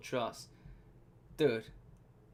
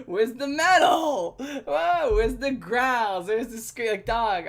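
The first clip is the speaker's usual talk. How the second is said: mock outrage; 6 semitones higher, 7 dB louder, slower than usual. Real, not acted.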